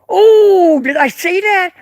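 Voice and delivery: high, drawn-out fake "oops"; mock surprise. Streamer pretending to let a secret slip, mock surprise.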